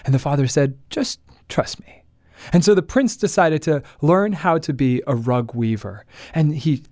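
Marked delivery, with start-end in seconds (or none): 0.9-1.9 soft whispered reassurance; whispering. Acted. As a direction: whisper it like a secret. Father answers the prince.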